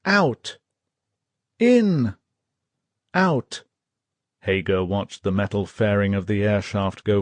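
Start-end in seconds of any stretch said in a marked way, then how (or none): 0.0-3.6 high, loud drill-like calls; officer calling the breathing rhythm; yelled commands. Acted. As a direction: shout each breath command. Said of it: "yelled the officer".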